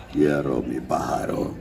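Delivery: low, soft Russian villain growl-down; Iván Vanko's Russian accent. Retold or imitated. Comedian quoting the Iron Man villain, Russian accent. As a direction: drop deep and quiet into a Russian accent. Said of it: "precisamente por el acento ruso".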